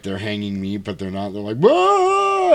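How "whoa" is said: high loud whoa to spook someone; mock scare shout. Pranksters under sheets spooking her, loud high shout. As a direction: jump out and yell.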